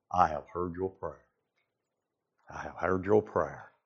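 very soft and low, unhurried; whisper-soft God answering; hushed voice of God. Acted. God's voice, hushed.

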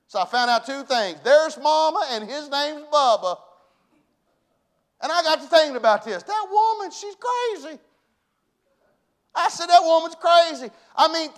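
loud, high-pitched preacher's exclaiming; fired-up preaching. Real, not acted. Preacher telling the T-ball story to church, fired up.

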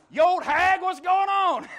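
high, loud hammed-up insult; a preacher joking about yelling at his wife, hammed-up yell; mock yell. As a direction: shout it through the door in jest. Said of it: "I wouldn't say that out loud".

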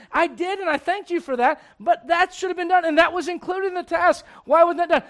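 lecturing mom. Acted.

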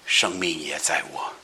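low, soft and solemn; Jesus speaking. Acted. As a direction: speak it gravely and gently. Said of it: "耶稣对他说".

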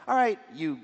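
brash mock recruiter quizzing apostles; mock interviewer.

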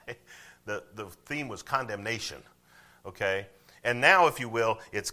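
usual speaking voice

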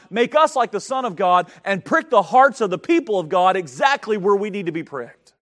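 loud, driving sermon delivery; fiery preaching. Acted. Pastor preaching to his church, loud and fervent. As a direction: preach it hard to the congregation.

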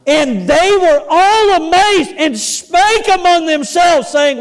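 slow, high, ringing pulpit reading; emphatic scripture reading. Acted. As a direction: declaim the verse with rising force.

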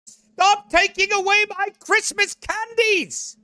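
high-pitched, hammed-up protest; mock tantrum. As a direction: whine it high like a thwarted child. Voicing upset complainers about Christmas.

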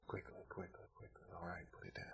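soft, breathless whisper during prayer; a quiet whispered nudge to the congregation; whispered urging. Real, not acted. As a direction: hush it so heads stay bowed. Hushed call during altar prayer.

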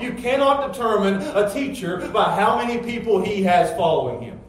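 loud, high, emphatic rebuttal; forceful preaching. Real, not acted. Preacher correcting the congregation on judging teachers, forceful preaching. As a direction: drive the point home from the pulpit, loudly.

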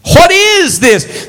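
imitating a baffled complaint, slowly; mock bewildered question. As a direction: stretch the question out in disbelief. Preacher repeating the question, building.

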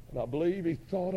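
ordinary delivery

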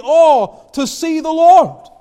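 high, loud, yearning exclamation; longing cry. Acted. Voicing John's longing cry.

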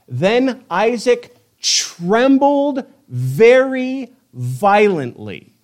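very drawn-out words, pitched high then dropping; slow, suspenseful Bible reading; dramatic scripture narration. A narrator's reading. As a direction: stretch each word for dramatic weight.

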